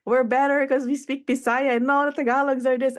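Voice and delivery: lightly mocking a boastful crowd; mock boastful Bisaya. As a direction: play the smug regional rival, quick and high.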